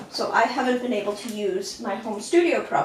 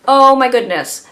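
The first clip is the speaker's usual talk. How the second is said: much louder exasperated oh my goodness; exasperated exclaim; 10 dB louder than usual. Real, not acted. Vlogger exclaiming over winter depression. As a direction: burst out in dismay.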